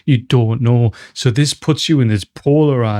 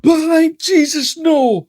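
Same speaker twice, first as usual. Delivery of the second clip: real anguished shout; much slower than usual. Real, not acted. Viewer shouting at a death in the film, real anguished cry. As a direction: yell it out in disbelief.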